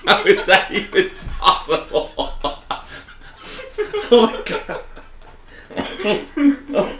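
breathless laughing words. Real, not acted. Still cracking up at the pun, breathless and laughing. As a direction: squeeze it out between laughs.

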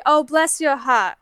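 slow Southern drawl with dropped R; Southern woman's accent. Retold or imitated. Southern woman warning someone off, slow Southern accent. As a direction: imitate a Southern woman, drawn out. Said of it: "You get a Southern woman say".

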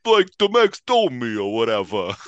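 a hint of a Boston accent. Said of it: "great Mark Wahlberg impression".

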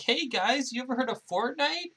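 put-on a high, eager young hero voice; Tom Holland impression. Tom Holland imagined greeting the Avengers, high and eager. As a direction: pitch way up like a keen teenage superhero. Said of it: "Tom Holland's gonna show up and he's gonna be like".

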